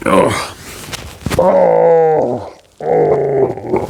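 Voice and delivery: long high-pitched play groans; mock groan of pain. Dad hugged hard by the kid, mock pained groans. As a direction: groan as if being squeezed too hard.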